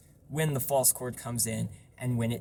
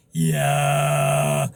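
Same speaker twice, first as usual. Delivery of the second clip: throat-sung yeah; 8 dB louder than usual. Shown as a demonstration. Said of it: "get some overtone singing done".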